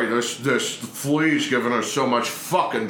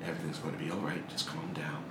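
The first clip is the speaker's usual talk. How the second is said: much softer, faster hush; soft soothing whisper; 6 semitones lower, 11 dB quieter, faster than usual. Acted. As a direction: whisper to calm him down. Soothing the prisoner.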